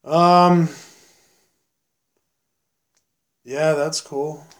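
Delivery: lightly voiced friend, slow and drawn out with long pauses; a hint of a slow-talking buddy, leave dead air between words; Ryan impression. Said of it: "let me do my Ryan impression".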